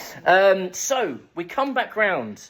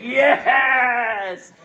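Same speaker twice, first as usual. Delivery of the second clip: loud, high, laughing yes; real delighted shout; 8 semitones higher, 5 dB louder than usual. Real, not acted. Guide cheering his own Margate joke, laughing shout. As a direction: cheer your own bright idea.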